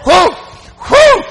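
imitated shout of "whoo"; piercing, high holler of joy; high loud whoop. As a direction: whoop like a fired-up Bible reader. Imitates father shouting in kitchen.

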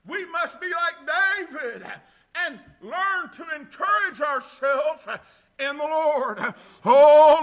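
loud whooping preacher cadence, building; full preaching. Acted. Pastor exhorting the congregation in a loud preaching cadence. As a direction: preach it to the church at full force.

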